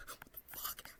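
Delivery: breathless, unvoiced what the fuck; stunned whisper. Retelling the chat, she whispers in disbelief. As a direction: mouth it in shock. Reacting to his age reveal.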